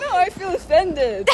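stifled giggles. Real, not acted. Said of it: "She's trying not to laugh".

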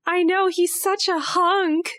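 high, gushing fangirl squeal; swooning fan. Acted. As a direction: gush over the vampire crush.

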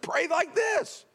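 mock-preachy shouted instruction; bossy command. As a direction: bark the formula like a know-it-all. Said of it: "If a person said".

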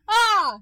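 short, high, loud yelp; demonstrated vocal scream. Shown as a demonstration. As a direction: let out a sudden mock scream. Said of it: "to the point where vocally".

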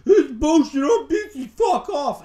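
high, loud dismissive yell; mock flip-out. Boyfriend flipping out at being asked to talk, mock angry shout. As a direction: blow up in an exaggerated tantrum. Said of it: "if he flips out and he goes like".

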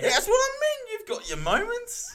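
high, laughing banter; laughing at his friend while teasing him; laughing tease. Real, not acted.

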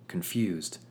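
plain reading voice

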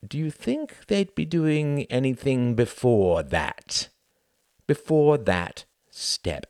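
slow, prim, probing questions; schoolmistress tone. A narrator's reading. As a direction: lecture him like a strict teacher. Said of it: "that schoolmistress tone".

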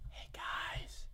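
hushed, secretive greeting; soft conspiratorial whisper. Pretend. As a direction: whisper it close to the audience. Secret aside into the mic.

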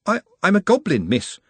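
high, halting confession; hesitant. A narrator's reading. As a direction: stumble on the first word, meekly. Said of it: "He hesitated as he said it".

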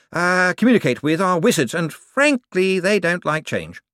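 high, eager, bright-voiced wizard; a jumpy official covering for himself. A narrator's reading. Hicks explaining robes and theatre.

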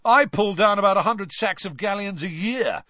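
loud, high bragging shout; young wizard brags to the Veela, shouting; shouted boast. A narrator's reading. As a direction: show off loudly to impress. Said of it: "one of them shouted".

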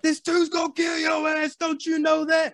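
high shouted scream. Retold or imitated. Retelling Joshua warning the fighter, high screamed. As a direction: scream it like a desperate cornerman. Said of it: "He's, he's screaming".

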